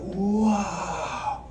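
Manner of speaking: high, slow fake wonder; mock awe. Shown as a demonstration. Comic pretending to be awed by a bookstore, high mock wonder. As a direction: gawk at it like a tourist. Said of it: "That's what I do when I go in".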